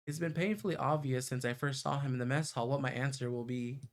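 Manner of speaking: plain reading voice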